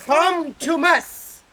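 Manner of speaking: "hum to mus" said slowly, syllable by syllable, with stress on "hum"; name pronunciation. Shown as a demonstration. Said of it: "help coach and how to say my name".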